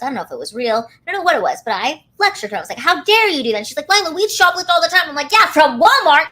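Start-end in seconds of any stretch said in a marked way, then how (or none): 2.8-3.6 loud scolding. Retold or imitated. Lecturing friend over stolen necklace. 3.9-5.1 defensive friend. Retold or imitated. Friend's retort, quoted. 5.3-6.3 loud, high retort; sarcastic retort. Retold or imitated. Retort to friend, quoted.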